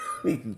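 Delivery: a short high choked sob; a real catch of emotion in the throat; brief sob breaking through. Real, not acted. Breaks while recalling crying.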